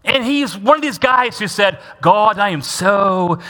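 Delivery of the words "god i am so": drawn-out, put-on British piety; mock British accent. Preacher mimicking Paul the Pharisee praying, mock British accent. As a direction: pray in a hammy British accent. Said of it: "he probably spoke with a British accent".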